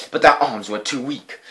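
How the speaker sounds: usual speaking voice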